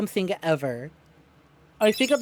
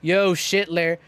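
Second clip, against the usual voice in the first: 1 casual Duke greeting; 6 semitones lower, slower than usual. Retold or imitated. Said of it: "Duke Nukem just casually strolls up".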